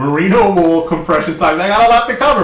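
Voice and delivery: fast excited pitch. Acted. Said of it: "You are speaking so fast".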